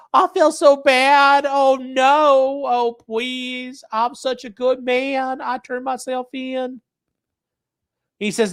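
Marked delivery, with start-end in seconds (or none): 0.1-6.8 higher, slow mock-sorry whine; mocking whiny voice. Streamer mocking the killer's apology, whiny mock voice. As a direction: ham up a fake remorseful sob. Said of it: "he's just like".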